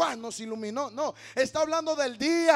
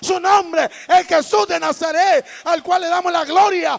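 shouted, rising sermon proclamation; full-force preaching; 4 semitones higher, 15 dB louder than usual. Real, not acted. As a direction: proclaim it at full volume, phrase after phrase. (prédica proclamada a gritos)

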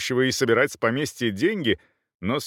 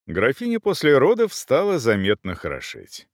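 plain reading voice

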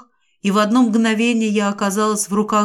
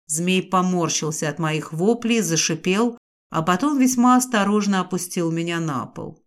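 plain reading voice

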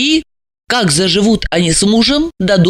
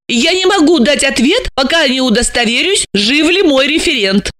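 higher-pitched firm refusal; cutting reply; 7 semitones higher than usual. A narrator's reading. Alexandra cuts off the caller's demand, firm and high. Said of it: "отрезала Ася".

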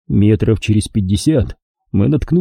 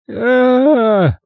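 despairing howl; 12 semitones higher than usual. A narrator's reading. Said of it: "обречённо взревел Огонян".

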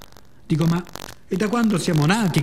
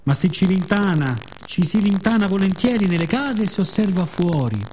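ordinary talk

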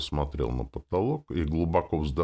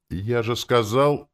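slow, higher-pitched faltering protest; unsure; 8 semitones higher, 6 dB louder, slower than usual. A narrator's reading. Coachman repeats his refusal to the passenger, unsure. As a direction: protest without real conviction. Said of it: "без уверенности продолжил возчик".

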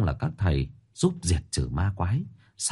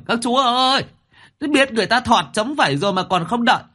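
high, loud whining cripple's wail; scared lame man shrieking high and loud for his friends to wait; frightened wail; 14 semitones higher, 5 dB louder than usual. Acted. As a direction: wail high and loud after the friends who left him. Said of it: "sợ mà gào lên".

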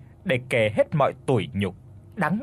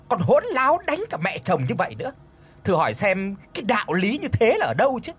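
scornful scolding; 6 semitones higher than usual. Acted. Sly cousin attacks daughter-in-law.

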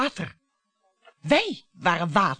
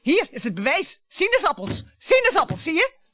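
triumphant cry; 8 semitones higher, 7 dB louder than usual. Acted. Wife triumphantly shows proof to husband.

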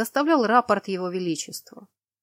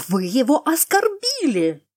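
loud, high-pitched indignation; outraged scolding at raised pitch; furious outburst; 6 semitones higher, 7 dB louder than usual. A narrator's reading. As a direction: burst in with a slow, scandalized accusation. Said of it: "Разъярённая Пифаль ворвалась в комнату".

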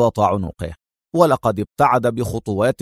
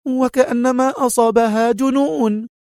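high, urgent woman's voice; panicked head nurse; 11 semitones higher than usual. A narrator's reading. Head nurse rushes up about the alarms, high and panicked. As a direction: cry out in panic.

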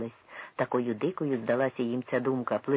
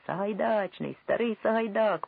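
1 voices drowning him out; 8 semitones higher than usual. A narrator's reading. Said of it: "покрили його голос інші голоси".

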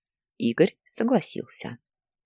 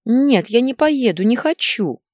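put-on high, louder child's protest; tearful boy; 8 semitones higher, 6 dB louder than usual. A narrator's reading. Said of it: "плакал мальчик". (плаксивый детский протест)

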